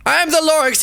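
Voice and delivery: high, coughing little-creature voice; the Lorax announcing himself, wheezy and high; Lorax's voice. A narrator's reading. Lorax introduces himself to the Onceler, high and coughing. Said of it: "he coughed and he whiffled".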